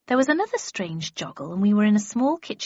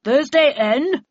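lightly voiced official, loud and higher; heavy-breathing magistrate; 6 semitones higher, 8 dB louder, slower than usual. A narrator's reading. Magistrate summons the next accused, loud official call. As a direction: call out the name like a court official. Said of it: "fat man who breathed heavily".

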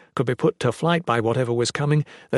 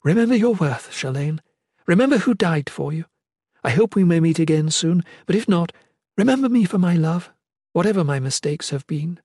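higher, earnest parting words; loving mother's farewell; 5 semitones higher than usual. Acted. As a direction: plead to be remembered by your daughter.

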